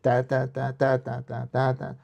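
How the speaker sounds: ta ta ta with steady accents; a vocal demo of an up-down arpeggio; up-down ta rhythm. Shown as a demonstration. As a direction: mouth the up and down pattern. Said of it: "Or if you have up and down".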